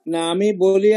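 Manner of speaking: normal talking voice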